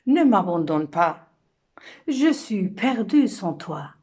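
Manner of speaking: imploration forte et montante; supplication. Acted. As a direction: supplier à voix haute, désespérément. La femme supplie l'homme qui part. (femme abandonnée qui implore)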